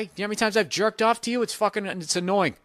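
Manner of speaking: usual speaking voice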